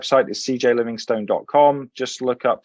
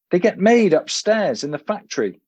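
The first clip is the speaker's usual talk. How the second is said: higher, polite British professor; fussy old English professor; 8 semitones higher than usual. A narrator's reading. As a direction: play the dotty host remembering his manners. Professor voice in story.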